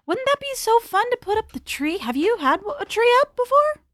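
high, bright coaxing voice; sweet voice to a child. Acted. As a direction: coax a little girl sweetly. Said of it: "To her".

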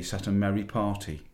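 ordinary delivery, nothing marked

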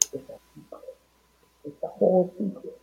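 whispered secret. Real, not acted. Host keeps the surprise guest secret in a whisper. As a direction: whisper it so nobody hears. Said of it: "Let me not talk too much".

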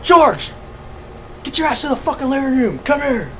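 high, fast angry summons; pissed off. Retold or imitated. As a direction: retell dad's furious call. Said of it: "how fucking pissed he sound".

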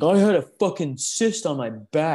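mocking CM Punk. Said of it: "C M Punk was like".

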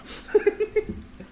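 breathy squeal of laughter; high wheezing laugh. Real, not acted. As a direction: crack up high. Laughing at police bit.

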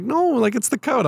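put-on high, nasal whiny valley girl. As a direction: whine it like a spoiled teen. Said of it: "she does her, like, whiny voice".

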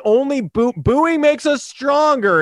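mocking, slow and high. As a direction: sneer it out slowly, high. Mocking Astros mantra.

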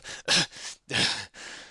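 vigorous cough; noisy boy's cough. A narrator's reading. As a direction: cough like you've run all the way. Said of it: "coughed with noisy vigour".

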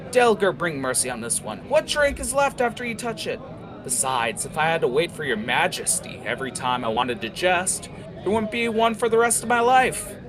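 high, mocking banter; teasing tiefling. Jackal teasing Heather about her drinking, high and mocking. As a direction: needle her with a grin.

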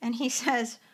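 normal talking voice

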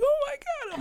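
freaking out. Retold or imitated. Friend shown the engagement ring, squealing in excitement. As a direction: squeal it like you just saw the ring. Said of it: "I start, like, freaking out".

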